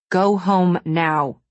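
slow, firm command; measured, no-nonsense order. A narrator's reading. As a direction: send them off firmly, word by word. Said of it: "using a firm tone".

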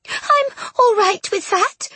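very high, slow, sobbing plea; tearful hiccup. A narrator's reading. Ghost girl pleading to be kept close, tearful. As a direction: cry it out slowly, voice pitched right up. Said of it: "let out a small hiccup".

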